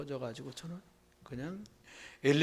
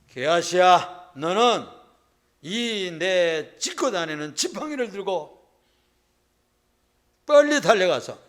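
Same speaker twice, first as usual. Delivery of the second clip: urgent command; 4 semitones higher, 11 dB louder than usual. Acted. Prophet commanding his servant.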